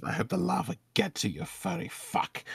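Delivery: high, hushed wizard grumble fading out; mumbled under breath. Acted. Wizard cursing fox customer to himself, mumbled. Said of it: "mumbles under his breath".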